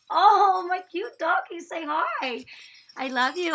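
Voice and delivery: high, loud cooing at the dog. Real, not acted.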